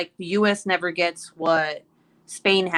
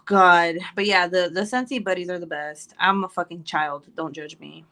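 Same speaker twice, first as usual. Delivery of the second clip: ordinary talk, nothing marked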